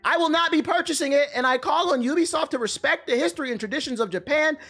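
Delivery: high, pompous, self-righteous read; mock outraged commenter.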